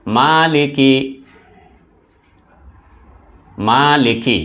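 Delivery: মালিকি said with a long clear final ি; মালিকি sounded out carefully; pronunciation demo. Shown as a demonstration. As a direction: stretch the end of মালিকি, not মালিক. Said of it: "ওটা মালিক না, মালিকি".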